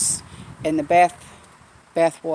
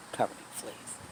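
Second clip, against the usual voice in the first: horrified whisper; 6 semitones lower, 14 dB quieter than usual. Real, not acted. Vlogger telling viewers about the rescued dog, aghast whisper. Repeats the horror of the fleas.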